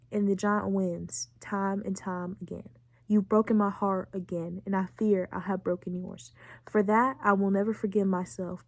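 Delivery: subdued, tender read-aloud; letter reading. Acted. Reading Mary's farewell letter to Arthur, subdued letter reading.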